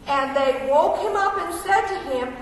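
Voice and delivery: ordinary talk, nothing marked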